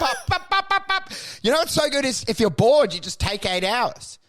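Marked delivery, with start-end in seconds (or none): 0.0-1.1 high gleeful pops; gleeful popping. Acted. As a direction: pop them off happily, higher.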